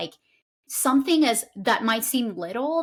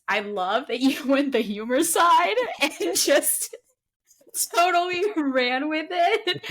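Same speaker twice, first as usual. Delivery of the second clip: laughing through the words, higher and slower; laughing fondly while talking, pitched up; 5 semitones higher than usual. Real, not acted. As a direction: giggle as you praise him.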